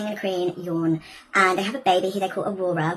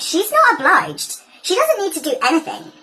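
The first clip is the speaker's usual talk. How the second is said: indignant insistence; 6 semitones higher, 4 dB louder than usual. Acted. Teacher defends the princess's choice to her class, indignant. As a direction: insist on it in outrage.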